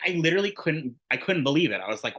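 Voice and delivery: usual speaking voice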